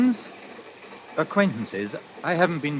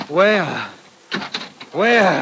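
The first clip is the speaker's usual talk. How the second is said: desperate cry; 4 semitones higher, 5 dB louder than usual. Acted. Dazed man searching for escape.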